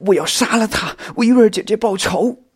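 high, loud cry of rage; furious, tearful. A narrator's reading. Xiao Xin vowing revenge for the sister, furious and tearful. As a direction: shout revenge through tears. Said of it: "小新非常气愤，边哭边说".

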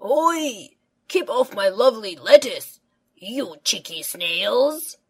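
loud, fast playful telling-off; hammed-up grumpy gardener yelling; mock-stern call. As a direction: call out like a grandad shooing pests. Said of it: "calls Grandpa".

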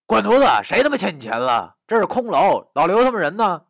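loud, high, indignant retort; baffled and irritated. Acted. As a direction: curse them off as if they make no sense. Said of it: "关明莫名其妙地说".

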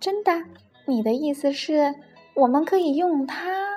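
high, hopeful questioning; eager. A narrator's reading. Penguin learning the plane flies, eager. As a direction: ask as if hardly able to wait. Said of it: "达芬奇迫不及待地说".

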